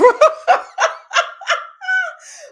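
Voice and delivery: helpless high laugh; high shrieking laugh. Real, not acted. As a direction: burst into a high, loud cackle. Cracking up at Habibi.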